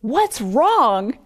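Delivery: "What's wrong?" thrown back in disbelief; incredulous echo of the question; hurt wife echoing her husband; exasperated wife. Retold or imitated. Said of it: "I can just imagine Hannah saying".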